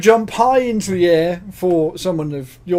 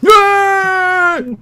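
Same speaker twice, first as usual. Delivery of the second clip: excited cheer; 8 dB louder than usual. Real, not acted. Cheering the win.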